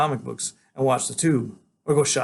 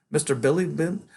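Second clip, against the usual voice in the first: usual speaking voice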